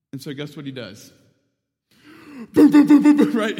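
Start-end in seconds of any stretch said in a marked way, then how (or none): 2.5-3.3 loud, high, rapid-fire booms; imitated door pounding. Joe trapped in the freezer beats the door, imitated loud pounding. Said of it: "starts beating on the door".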